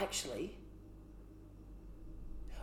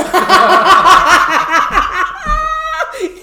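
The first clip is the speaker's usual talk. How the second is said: howling high laughter; loud laugh; 12 semitones higher, 20 dB louder than usual. Real, not acted. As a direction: burst out laughing loudly. Laughing at the joke.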